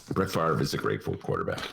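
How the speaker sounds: a light take on Barack Obama's measured cadence; lightly voiced Barack Obama; Barack Obama impression. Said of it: "uh, Barack Obama".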